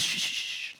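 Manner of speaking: soft soothing shushes; gently quiet someone frantic; hushing. Acted. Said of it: "and he says".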